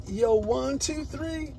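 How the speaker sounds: ordinary talk, nothing marked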